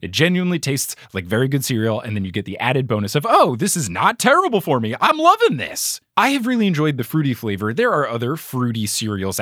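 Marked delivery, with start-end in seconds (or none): 3.3-6.0 bright pleased gush rising high; delighted inner voice. Pretend. Podcast host voicing a happy eater's delight. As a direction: play the thrilled cereal eater.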